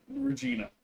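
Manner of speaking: high sing-song pet call. Real, not acted. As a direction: coo her name way up high. Calling the cat sweetly.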